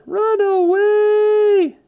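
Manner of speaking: high, drawn-out yell to flee; panicked shout. Real, not acted. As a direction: shout it high and long while running away. Spotted, fleeing the robots.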